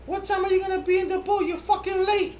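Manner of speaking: very high, quick mock nagging; imitated woman. Host voicing a woman texting about lateness, high hammed-up scolding. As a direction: squeal it as an impatient woman. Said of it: "And then she said".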